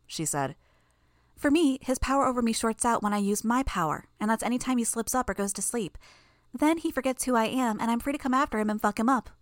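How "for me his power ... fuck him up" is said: put-on lightly higher, cocky girl, quicker at the end; a hint of a smug young girl; gleeful, breezy girl; Imp's voice. A narrator's reading. Narrator voices Imp.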